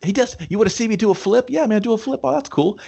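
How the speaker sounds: high, rapid-fire eager chatter; mock excited show-off. Friends mocking endless flip tricks, mock excited.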